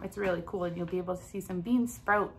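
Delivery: normal talking voice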